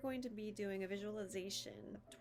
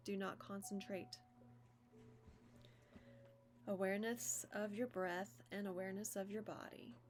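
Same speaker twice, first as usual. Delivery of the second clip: measured yoga nidra guidance, long pauses; even, deliberate meditation-teacher voice; guided meditation. Acted. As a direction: guide them into stillness, phrase by phrase.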